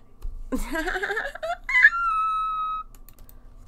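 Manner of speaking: very high squealing laugh; real loud squeal of laughter; high shrieking laugh. Real, not acted.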